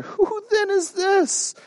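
high, slow, hushed awe; awestruck wonder. Retold or imitated. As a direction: ask it slowly, full of wonder. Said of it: "they said to one another".

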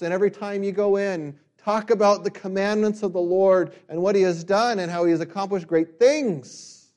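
loud, high, fervent preaching; preaching at full force. Acted. Preacher urging parents to talk of the Lord, fervent and loud. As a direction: drive the point home to the room.

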